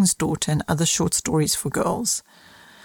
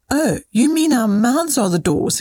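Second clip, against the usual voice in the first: put-on higher storybook boy's realisation; lightly acted excited discovery; mild exclamation; 6 semitones higher, 3 dB louder than usual. A narrator's reading. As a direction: exclaim as a child who has just worked it out. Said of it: "exclaimed Harry".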